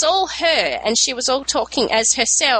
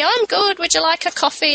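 lightly voiced confused old mother-in-law, higher; a hint of a sweet, muddled mother-in-law offering coffee; 6 semitones higher than usual. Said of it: "And she'd be".

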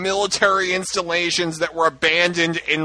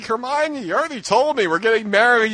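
lightly voiced Harry, a bit higher; a hint of hammed-up hero; mock heroic Harry; 6 semitones higher than usual. Mocking the fic for co-hosts, a lightly hammed Harry. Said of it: "and he's like".

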